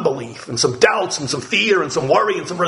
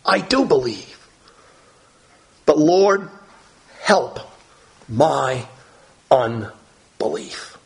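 tearful plea; much slower than usual. Retold or imitated. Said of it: "So he's in tears, and he says".

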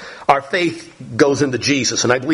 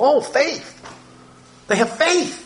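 mocking vague faith; 9 semitones higher than usual. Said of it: "you may hear a lot of people talking about".

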